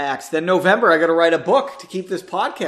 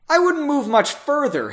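loud warning; 9 semitones higher, 3 dB louder than usual. Acted. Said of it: "Henry warned loudly".

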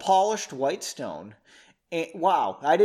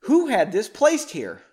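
higher, louder demand; furious shout; 6 semitones higher, 6 dB louder than usual. A narrator's reading. Margot shouting at the servant over the rose. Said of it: "she yelled".

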